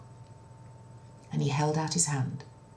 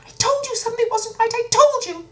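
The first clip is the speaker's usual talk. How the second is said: very high, loud, fast panicky voice; nervous youngest princess; 10 dB louder than usual. Acted. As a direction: fret like a frightened little girl. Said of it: "They were nervous".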